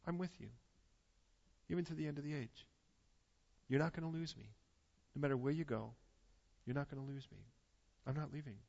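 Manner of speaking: hushed, gentle voice of God promising to stay; soft tender promise. Acted. Preacher voicing God's promise to the frightened believer, hushed and tender.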